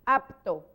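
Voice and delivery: apto, lips closing firmly on the P; clear apto. Shown as a demonstration. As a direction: press the lips together for the P in apto. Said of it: "el sonido P es labial".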